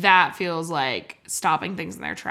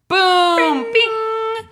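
high, loud vocal boom and ringing bing; game-show sound effects; 12 semitones higher, 6 dB louder than usual. Pretend. Playing File Jeopardy, voicing high, loud sound effects. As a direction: imitate a quiz board chime. Mimics quiz-board sounds after Jeopardy bit.

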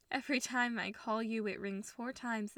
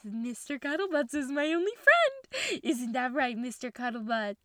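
forced cheer; 7 dB louder than usual. Acted. Talks brightly to stuffed dog.

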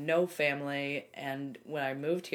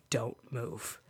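hushed frozen whisper; 3 semitones lower than usual. Retold or imitated. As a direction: whisper like not daring to move. Frozen afraid to touch anything.

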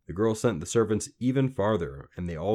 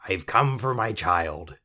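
slow, croaked demand; croaky little man, menacing; 4 dB louder, slower than usual. A narrator's reading. Little man claiming the child, slow menace. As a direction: claim the prize slowly.

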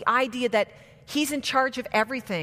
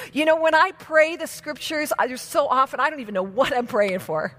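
usual speaking voice

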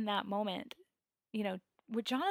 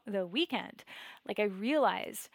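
plain reading voice

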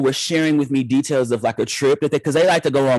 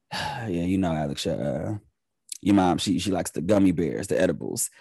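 low, quiet resigned dad; sheepish dad; 7 semitones lower, 7 dB quieter than usual. Retold or imitated. Dad owning up to his son, low and quiet. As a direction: drop low and soft, a sheepish confession. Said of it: "my dad had to be like".

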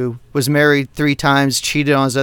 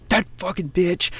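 softer, bitter curse under the breath; muttered curse; 4 semitones higher, 6 dB quieter than usual. Retold or imitated. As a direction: spit it out quietly once she's gone. Swearing after she left the room.

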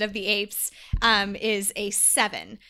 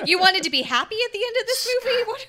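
higher and fast, teasing incredulity; rapid-fire mock shock at a friend's naive hope; mock disbelief; 10 semitones higher, much faster than usual. Real, not acted. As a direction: scoff at the idea like it's absurd. Mocking friend's hope for happiness.

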